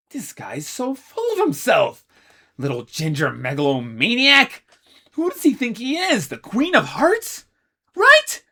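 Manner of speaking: high, loud fuming rant. Acted. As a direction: rant about the tyrant. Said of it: "Ace fumed".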